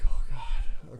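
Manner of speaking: whispered "oh God" reaction; breathless. Retold or imitated. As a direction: imitate a breathless, whispered dismay. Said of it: "couldn't breathe too well".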